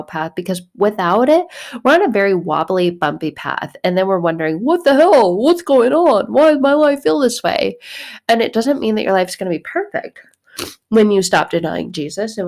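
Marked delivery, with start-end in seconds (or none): 4.6-7.8 much higher, louder exasperated cry; exasperated outburst. Retold or imitated. Lost believer crying out at their messed-up life, exasperated. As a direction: throw up your hands in frustration.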